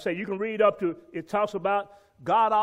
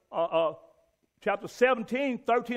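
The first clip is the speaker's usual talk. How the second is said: ordinary delivery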